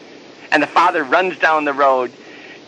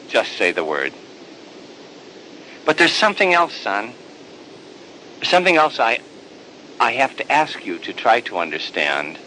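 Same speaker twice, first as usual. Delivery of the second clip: tender father; 4 semitones lower than usual. Acted. Father gently reassures his resentful older son, low and tender. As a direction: soothe him like a father pleading with love.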